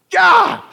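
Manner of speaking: high, loud frustrated yell. Retold or imitated. Pastor as a boy protesting church, high loud yell. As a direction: blurt out a kid's protest yell. Child reacting to being dragged.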